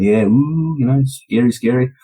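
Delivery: playful mock scare; teasing, stirring up. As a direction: wind him up with pretend ghostly scares. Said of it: "I'm, like, stirring him up".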